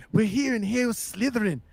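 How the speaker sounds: Aussie wildlife host voice; nature documentary. Pretend. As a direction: present the snake like a hushed Aussie host. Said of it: "this image of him playing with Voldemort".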